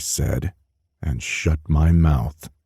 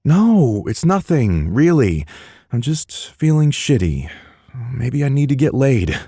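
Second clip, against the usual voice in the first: defensive brush-off; 9 semitones higher than usual. Acted. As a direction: push the worry away fast. Deflecting loudly.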